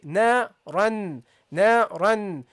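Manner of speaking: naran slow with humming n; nasal ghunnah. Shown as a demonstration. Teacher showing nasal sound to students. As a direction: hum the n of naran through the nose. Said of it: "you make a sound".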